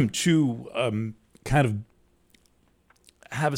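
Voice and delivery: throat catching mid-sentence; fighting a tickle in the throat; choking cough. Real, not acted. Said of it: "you're choking me".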